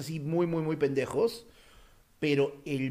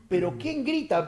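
puzzled waking thought; 6 semitones higher than usual. Retold or imitated.

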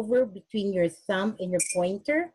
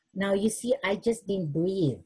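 ordinary delivery

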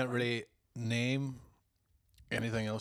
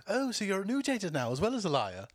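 quoted character retort; 9 semitones higher than usual. Retold or imitated. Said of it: "Will was all".

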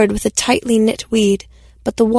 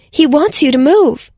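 raised voice, higher and louder; mild shout over the commotion; 6 semitones higher, 4 dB louder than usual. Acted. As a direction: call across the clearing. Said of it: "Anna shouted".

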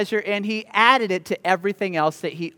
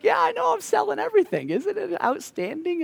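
gleeful seller; 12 semitones higher, 5 dB quieter than usual. Pretend. Gleeful seller answers the neighbors.

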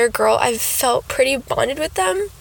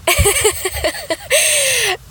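giddy high laugh; 13 semitones higher than usual. Real, not acted. Happy laugh at news.

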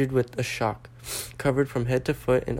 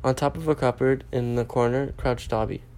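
usual speaking voice

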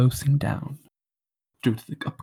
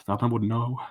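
ordinary delivery

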